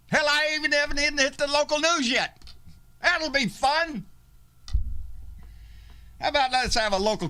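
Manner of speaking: loud high furious ranting; angry rant. Real, not acted. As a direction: shout down the neighbor.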